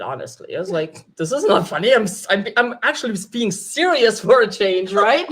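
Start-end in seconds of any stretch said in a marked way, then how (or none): 1.2-5.2 retold indignation, louder and a little higher; playfully put-out, insisting on being taken seriously; mildly offended. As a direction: protest in mock offence. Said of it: "I was a little offended".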